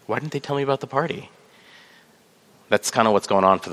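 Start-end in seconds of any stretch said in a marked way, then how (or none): 0.1-1.3 higher, softer, plaintive grumble; a wounded little moan about being left out; whiny left-out complaint. Retold or imitated. Guest left out of the party, mock whining softly. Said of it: "and you're like".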